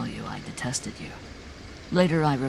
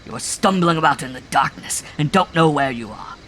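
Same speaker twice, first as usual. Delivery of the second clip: loud, much higher, ranting tirade; furious shouting rant; 6 semitones higher, 7 dB louder than usual. A narrator's reading. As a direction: hurl it as a raving sermon of contempt. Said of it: "he shouted".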